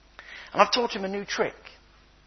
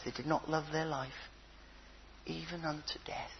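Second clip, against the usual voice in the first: whisper; 5 semitones lower, 16 dB quieter than usual. Acted. Solemn Bible quote.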